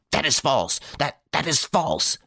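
high, agitated, stumbling protest; frantic denial. A narrator's reading. Varin frantically denies the accusation.

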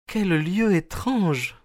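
high, slow, sighing woman's voice; sighed remark. A narrator's reading. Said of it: "soupira-t-elle".

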